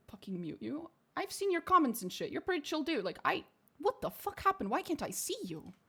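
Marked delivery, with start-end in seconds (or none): none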